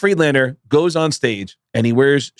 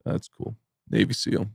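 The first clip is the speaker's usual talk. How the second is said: very soft, low deadpan one-upping; casual boast dropped quietly; Judah Friedlander; 4 semitones lower, 10 dB quieter than usual. Retold or imitated. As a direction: imitate a nonchalant braggart, barely voiced. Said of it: "And Judah goes".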